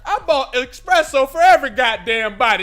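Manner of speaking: loud, high gang boss shouting; boss yelling. Acted. The boss shouts down his sidekick, loud and high. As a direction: bark orders as the boss. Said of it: "This is the call".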